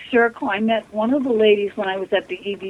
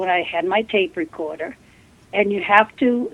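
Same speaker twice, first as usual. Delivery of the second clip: usual speaking voice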